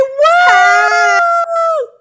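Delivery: high, loud celebratory whoop; excited cheer. Real, not acted. Host cheering her cohost turning fifty, loud whoop. Cheering host's fiftieth birthday.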